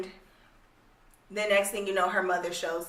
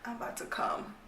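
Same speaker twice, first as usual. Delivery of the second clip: whispering softly; mock whisper; 4 semitones higher, 10 dB quieter than usual. Mocking the boyfriend in the book, whispered. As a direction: breathe it out, softer. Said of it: "and he's like".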